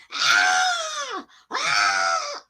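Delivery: two short high mock screams. Streamer acting out her screaming for chat, high mock screams. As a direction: replay the scream for the audience. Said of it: "I was up here screaming".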